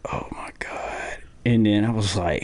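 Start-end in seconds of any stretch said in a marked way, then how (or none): 0.1-1.2 breathless slow whisper of shock; stunned whisper. Retold or imitated. Staring at the empty parking spot, whispering in disbelief. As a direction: barely get the words out, slowly.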